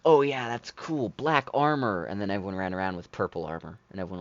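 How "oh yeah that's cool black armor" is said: loud, raised mock-enthusiastic crowd voice; imitating approval. Gamer mimics players first hearing of the armor, mock excited. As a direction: quote the fans' approval, louder and higher. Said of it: "everyone complained that they're like".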